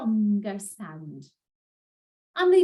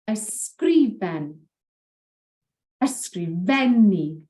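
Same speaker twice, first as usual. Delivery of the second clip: ysgrifen slow, stress on "gri", ysgrifennu stress on "fen"; penultimate stress; slower than usual. Shown as a demonstration. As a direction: lean on the last but one syllable. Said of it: "stress usually falls on the last but one".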